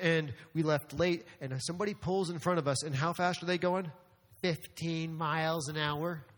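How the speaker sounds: ordinary talk, nothing marked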